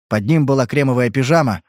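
ordinary delivery, nothing marked

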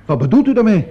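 indignant shock; shocked outburst. Acted. Accused man reacts to the accusation.

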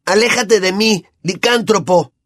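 Ron yelling. A narrator's reading. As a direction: spit it out in fear and disgust. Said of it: "Ron exclamó".

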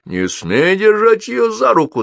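high, loud, drawn-out command; angry shout. A narrator's reading. Мор кричит мальчику, angry shout. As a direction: cry it out slowly on a high pitch. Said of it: "закричал Мор".

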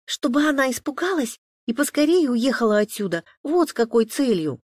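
put-on high, loud girl's outburst; impatient outburst. A narrator's reading. Liza can't hold back before her father, outburst. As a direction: burst out as a child who can't hold back. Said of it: "не выдержала Лиза".

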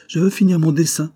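put-on high little girl's voice, insisting; a child who won't stop drawing; child protesting. A narrator's reading. Little girl insists on finishing her drawing, high-pitched. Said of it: "proteste la petite".

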